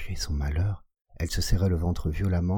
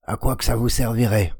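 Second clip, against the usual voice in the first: raised high and loud; louder, higher challenge to the visitors; 6 semitones higher, 6 dB louder than usual. A narrator's reading. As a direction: lift the voice up, suspicious and sharp. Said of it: "elle prononça d'une voix plus haute".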